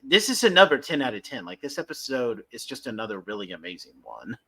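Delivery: ordinary talk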